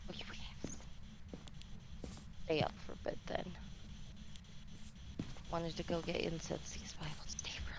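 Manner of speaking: soft, fast whispered chatter; whispering. Real, not acted. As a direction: keep it hushed and quick. Whispered side talk.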